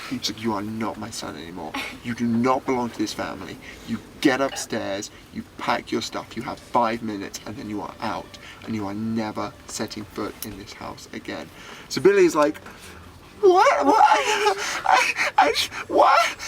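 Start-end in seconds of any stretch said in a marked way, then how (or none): 0.4-11.5 quiet, controlled parental fury; intense, not shouting. Retold or imitated. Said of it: "that intense parents get when they're not shouting". 13.4-16.4 very high, loud stammering wail; hammed-up sobbing disbelief; crying. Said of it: "crying, tears pouring down his face".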